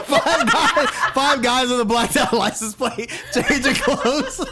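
can't stop laughing while saying it. Real, not acted. Laughing at absurd image.

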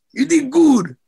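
a tough father softening into praise; proud dad. Retold or imitated. Boxer imitating his dad praising him after a loss, gruffly proud. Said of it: "he was like".

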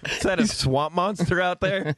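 news anchor voice. Retold or imitated. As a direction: play a stunned TV anchor. Mock news anchor reporting.

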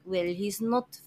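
put-on lightly German-accented Coco; a hint of a German accent. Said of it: "slipped into German there".

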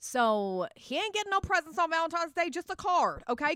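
high, emphatic sass; sassy. Real, not acted. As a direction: lay down the law with attitude. Sassy declaration about gifts.

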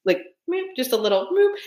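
tiny squeaky meep; a small high meep sound; startled meep. Shown as a demonstration. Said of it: "very small hand motions".

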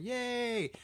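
a high, bright mock cheer. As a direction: cheer like the crowd, half-joking. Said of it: "and everybody's cheering".

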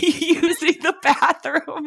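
high, drawn-out words through laughter; giggling high as you tell it; laughing. Real, not acted. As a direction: laugh your way slowly through it. Laughing while retelling comic scene.

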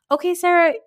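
high, loud mock exasperation; fed-up comeback, voice jumping high; exasperated retort. Mock retort to Sarah's bragging.

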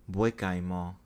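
Bois-Guillemot said as "pwa-ghee-mo" in a careful French accent; French accent attempt, repeated. Shown as a demonstration. Vlogger practising the Haitian place name, careful French accent. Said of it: "in my best French accent".

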